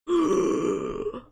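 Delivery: big loud gasp; mock shocked gasp. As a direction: gasp at a bombshell, hammed-up. Reaction to mom's revelation.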